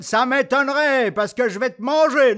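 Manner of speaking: loud threatening troll voice. Acted. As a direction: menace like a hungry troll. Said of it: "dit le troll".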